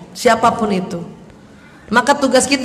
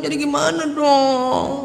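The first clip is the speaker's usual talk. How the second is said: slow, drawn-out plaintive wail; fearful again; 2 semitones higher, 4 dB quieter, slower than usual. Acted. Widow sliding back into fear after being scolded, plaintive. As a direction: imitate someone helpless and worried, stretching the last word. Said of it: "Jadi takut lagi".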